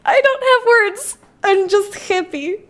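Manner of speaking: high tearful thanks, slow; happy crying. Real, not acted. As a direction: voice breaking with joy. Teary thanks after coming out.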